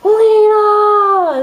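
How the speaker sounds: loud, high mock shout of the name Lena; retold shout. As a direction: shout Lena from the car as Ethan. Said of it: "he's like".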